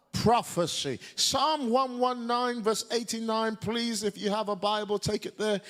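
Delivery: ordinary talk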